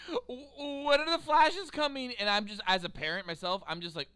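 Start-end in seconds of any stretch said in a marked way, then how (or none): 0.1-2.1 put-on kid voice. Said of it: "he's all like".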